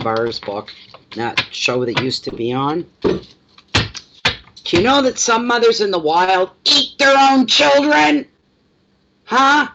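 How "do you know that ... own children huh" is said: loud, high mother's yell; mock threatening yell. Owner mock-threatening the dog like a sitcom mother, loud yell. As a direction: yell it like an exasperated sitcom mom. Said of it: "You gotta yell".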